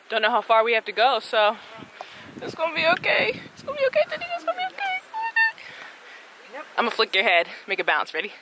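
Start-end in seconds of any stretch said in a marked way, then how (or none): 3.5-4.2 much higher, sing-song reassurance; high cooing. Real, not acted. Cooing to Teddy. 4.3-5.6 each time higher, soft sing-song soothing; climbing coo. Real, not acted. Cooing to Teddy, climbing higher.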